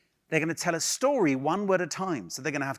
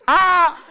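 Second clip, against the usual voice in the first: a high, loud "Ah!" cry; imitated shout; 14 semitones higher, 12 dB louder than usual. Coach recalls Alice's ride name, a high loud laughing "Ah!". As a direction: blurt the scream out laughing. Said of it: "the best name of a ride I've ever heard is".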